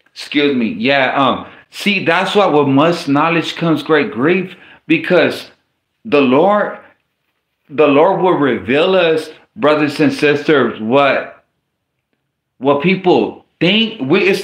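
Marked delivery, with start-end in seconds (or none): none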